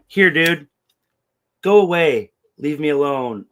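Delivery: shouting go away at pestering strangers; exasperated shout. Retold or imitated. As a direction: yell it to make them leave. Shoving weed at badgering dudes.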